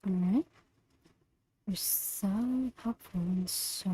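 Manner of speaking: soft and slow, like soothing a pet; soft, slow, reassuring praise for a good boy; tender affirmations. Acted. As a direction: murmur praise gently and slowly. Reading affirmations to Donut.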